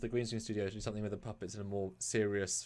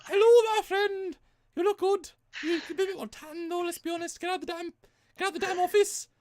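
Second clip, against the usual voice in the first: much higher, louder hammed-up Scottish puppet; 5 dB louder than usual. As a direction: greet her like a squeaky Scottish puppet. Keeps puppet voice.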